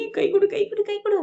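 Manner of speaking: high-pitched, eager urging; gleeful congratulation. Retold or imitated. Malar congratulates Mathi behind the closed door, high and gleeful. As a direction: sing it out high, in repeated eager bursts. Sister-in-law gleefully demands a handshake.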